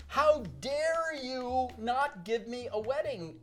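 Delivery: high, slow, outraged mock yell; hammed-up star outrage; imitating Elizabeth Taylor. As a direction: demand it like an offended star. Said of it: "I hear a voice yelling".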